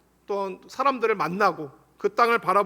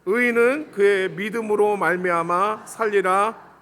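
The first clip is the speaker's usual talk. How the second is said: slow, deliberate verse reading; scripture recitation; 2 semitones lower than usual. Real, not acted. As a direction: read it out slowly for everyone to follow. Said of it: "말씀 같이 읽습니다".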